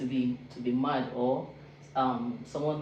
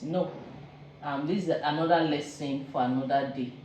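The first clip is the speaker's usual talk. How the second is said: usual speaking voice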